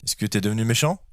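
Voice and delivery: usual speaking voice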